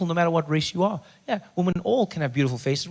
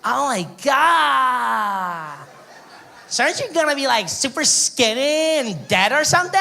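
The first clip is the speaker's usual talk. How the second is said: put-on high, shocked valley girl squeal; valley girl gasp; 6 semitones higher, 4 dB louder, slower than usual. American girl shocked by his answer, high squealing voice. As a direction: gush like a stunned clueless American girl. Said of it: "She's like".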